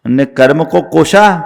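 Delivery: usual speaking voice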